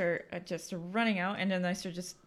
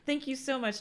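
higher-pitched, gushing thanks; effusive goodbye thanks; 7 semitones higher, 3 dB quieter, faster than usual. Retold or imitated. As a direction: thank him repeatedly to get away. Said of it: "trying to say goodbye to him".

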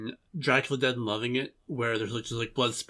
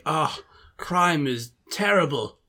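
hammed-up complaint, higher, rising into mock delight; mock lament turning gleeful; 6 semitones higher, 4 dB louder, slower than usual. Podcaster mimicking the detective bored with crime, hammed-up lament to glee. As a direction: moan it slowly, then relish the last word. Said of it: "he's been like".

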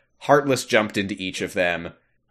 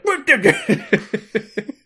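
startled Pete; 12 semitones higher, 4 dB louder, much faster than usual. Retold or imitated. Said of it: "he's like".

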